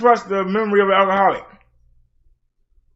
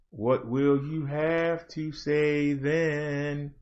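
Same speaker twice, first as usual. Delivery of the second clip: much softer, slow, lower challenge; slow hushed taunt; 5 semitones lower, 11 dB quieter, much slower than usual. Real, not acted. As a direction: let it sink in, slow and hushed. Repeated challenge, fading.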